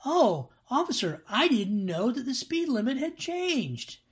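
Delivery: high, mock-innocent motorist pleading with an officer; excuse-making driver. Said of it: "And you're gonna say".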